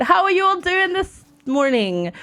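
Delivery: ordinary talk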